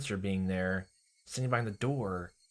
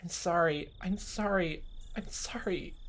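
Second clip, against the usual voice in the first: high pleading apology; pleading Rena; 9 semitones higher, slower than usual. Acted. Rena apologizing to Keiichi, high and pleading. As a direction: beg for forgiveness.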